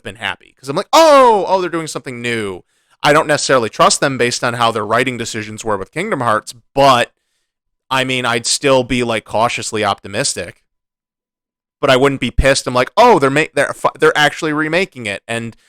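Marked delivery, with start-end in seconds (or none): 0.9-4.5 high, loud "oh" dropping back to normal; imagined reaction of happy surprise; pleasantly surprised. Retold or imitated. 13.0-15.2 mock "oh, I get it" reaction; lightly acted surprise, pitched up; imagined surprised realization.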